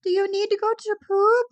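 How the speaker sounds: high sing-song imitation of a dog owner; mocking Jen's cooing at her dog, high baby talk. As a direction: ham up a cooing pet owner, pitched high. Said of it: "and you're like".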